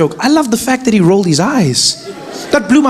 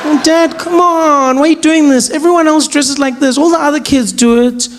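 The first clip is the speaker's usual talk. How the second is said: put-on whiny teen daughter; 6 semitones higher than usual. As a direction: plead she must fit in. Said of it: "daughter protesting".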